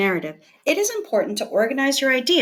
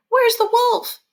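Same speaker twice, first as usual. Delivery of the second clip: eager townsfolk; 10 semitones higher, 4 dB louder than usual. Acted. Armed townsfolk rushing in.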